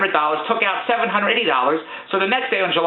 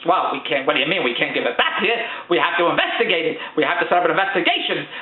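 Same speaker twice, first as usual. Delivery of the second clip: a hint of a flat, lower clerk voice, pitched down; lower, mock-official bank clerk; lightly voiced bank clerk; 7 semitones lower, faster than usual. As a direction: mildly imitate the unhelpful teller brushing him off. Retold bank clerk refusing him.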